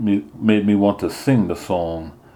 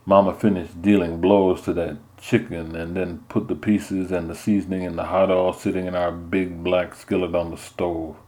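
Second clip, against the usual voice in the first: ordinary delivery, nothing marked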